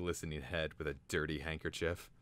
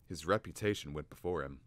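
ordinary delivery, nothing marked

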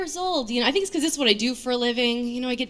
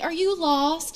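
higher, rising question; lightly voiced helpful stranger; concerned stranger; 6 semitones higher, slower than usual. Subway stranger asking the comic, concerned.

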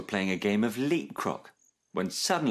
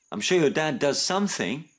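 higher, cheerful reassurance; a touch of chirpy encouragement; 6 semitones higher than usual. A narrator's reading. Max reassuring Snip about his dad, chirpy. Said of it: "chirped Max".

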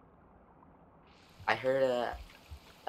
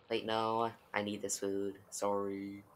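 ordinary talk, nothing marked

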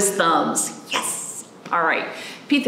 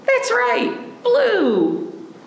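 cheerful praise; much slower than usual. Acted. Praising children's answer.

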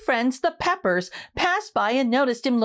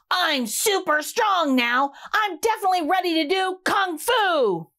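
proud proclamation; 6 semitones higher than usual. Acted. Rudy proclaims to the broccoli, high and proud. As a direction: puff up and announce it. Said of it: "and proclaimed".

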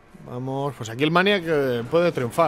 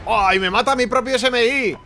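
high, startled yelp of protest; sudden pitched-up dismay; alarmed outcry; 7 semitones higher, 4 dB louder than usual. Real, not acted. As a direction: cry out as it backfires on you. Thinks own bomb kills him.

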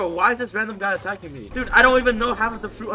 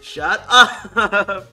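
taunting; 6 dB louder than usual. Real, not acted. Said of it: "I'm just gonna make fun of him".

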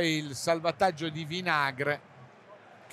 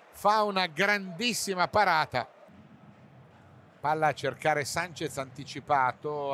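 ordinary delivery

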